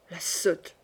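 soft, low scornful murmur. A narrator's reading. Queen mutters scornfully about her lady-in-waiting, low murmur. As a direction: mutter the insult under your breath. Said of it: "murmura la reine".

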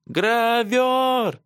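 high, drawn-out cry to a horse; high-pitched screamed call; shouting. A narrator's reading. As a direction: call out desperately at the top of your range. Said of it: "кричала она".

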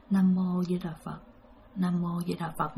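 murmured prayer. Retold or imitated. Terrified driver prays to keep the ghost away, very softly. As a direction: murmur the Buddhist prayer under your breath. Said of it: "bạn niệm liên tục".

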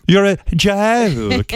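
very slow, deliberate mock British scolding; scolding Spicer in a British accent; British accent yelling. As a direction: drag out you're a joke in a British accent. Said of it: "I love that accent when somebody yells".

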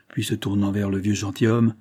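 usual speaking voice

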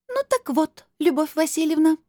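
very high, formal address; businesslike crystal voice. A narrator's reading. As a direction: get down to business in a high, ringing voice. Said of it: "Голос стал жёстким". (очень высоким голосом, по-деловому)

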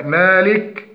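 مالك ending on a bare k, as a mistake; silent kaf vowel. Shown as a demonstration. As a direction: drop the final i of مالك. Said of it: "وتسكين الكاف". (مالك بتسكين الكاف)

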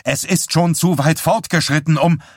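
a doctor snapping back in a cutting tone. A narrator's reading. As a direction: cut him off sharply. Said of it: "mit schneidender Stimme".

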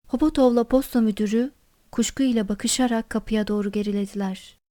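plain reading voice

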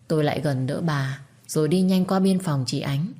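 ordinary delivery

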